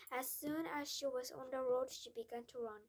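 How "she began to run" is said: ordinary delivery, nothing marked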